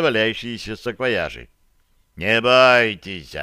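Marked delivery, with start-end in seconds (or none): 2.2-3.3 loud, slow booming reassurance; drawn-out, hefty bass-style delivery. A narrator's reading. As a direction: boom it out slowly like a big man. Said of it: "пробасил Барри".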